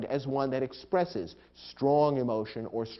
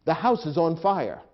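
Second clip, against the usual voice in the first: loud, raised-pitch cry of alarm; urgent exclamation; 5 semitones higher, 7 dB louder than usual. Shown as a demonstration. Warning of physical danger, urgent exclamation. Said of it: "great deal of emotion and urgency".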